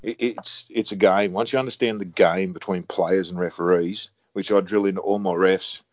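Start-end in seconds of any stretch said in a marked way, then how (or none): none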